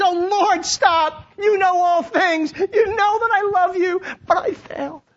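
high, tearful pleading; desperate cry from a wounded heart; anguished plea. Acted. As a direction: beg to be believed, close to crying. Peter pleads with Jesus, third time.

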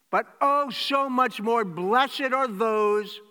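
loud, high, driving sermon voice; preaching at full force. Acted. Preacher to congregation, the risen Jesus greeting them, loudly. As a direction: proclaim it to the back row.

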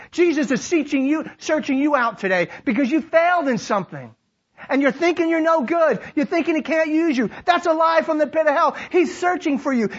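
high, driving sermon delivery; rousing, declamatory preacher; full-force preaching. Real, not acted. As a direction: preach it out to the whole room.

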